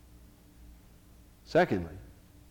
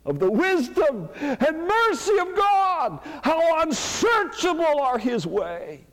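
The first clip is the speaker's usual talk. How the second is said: very slow, much higher, louder cry of awe; Paul's awed exclamation; 13 semitones higher, 5 dB louder, much slower than usual. Retold or imitated. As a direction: draw out each word in wonder. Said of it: "Paul comes to the end of this passage and says".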